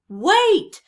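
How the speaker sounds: high, loud call after the crew; crying out. A narrator's reading. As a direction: yell it to halt the fleeing men. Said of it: "cried Cap'n Bones".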